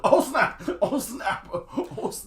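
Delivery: laughing out oh snap; giggling through the words. Real, not acted. Laughing at a diss.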